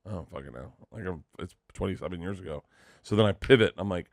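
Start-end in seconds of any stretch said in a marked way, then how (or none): 0.1-2.6 very soft and low, almost under the breath; mumbled retold answer. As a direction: reenact a sheepish answer, trailing low. Retold embarrassed answer to celebrity.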